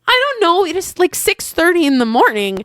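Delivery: high-pitched retold exasperation; exasperated. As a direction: imitate a flustered night auditor at a loss. Retold exasperated night auditor on phone.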